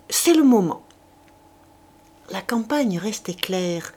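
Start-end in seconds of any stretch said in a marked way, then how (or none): 0.1-0.8 louder, higher call to go; shouted call. A narrator's reading. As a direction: call the others to charge. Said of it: "cria Chouteau". (crie que c'est le moment)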